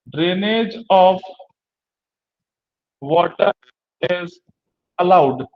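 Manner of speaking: each word slow and spaced for notes; slow dictation pace with long gaps. Shown as a demonstration. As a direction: dictate it slowly, word by word for the class. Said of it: "इस drain condition में कहेंगे कि".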